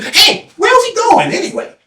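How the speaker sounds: high, questioning call; calling down the line. Acted. People in line asking each other, high and questioning. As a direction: pass the question forward. Last person asks.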